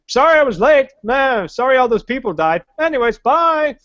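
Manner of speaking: breezy wizard brushing off the deaths; offhand wizard leaving again; mock Gandalf. Mock Gandalf after battle.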